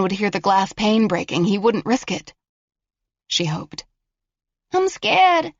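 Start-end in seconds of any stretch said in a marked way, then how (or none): 4.7-5.5 high, small scared whimper; little sister frightened. Acted. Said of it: "Andrea whimpered".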